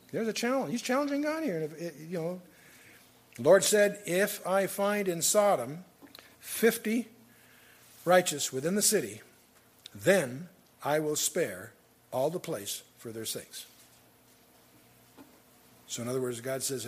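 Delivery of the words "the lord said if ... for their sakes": measured, solemn Bible reading, a touch louder; scripture reading. Acted. Preacher reading Genesis to his congregation, solemn scripture reading. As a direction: read the verses out with weight. Reads Genesis aloud in sermon.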